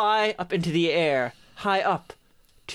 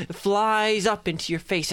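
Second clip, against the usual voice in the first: ordinary delivery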